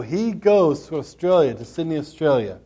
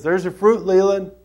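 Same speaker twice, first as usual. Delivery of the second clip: lifted, louder call upward; calling to heaven; 4 semitones higher, 4 dB louder than usual. Retold or imitated. Preacher calls up to heaven.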